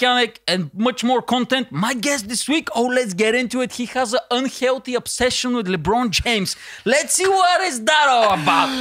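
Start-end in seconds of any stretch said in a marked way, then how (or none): none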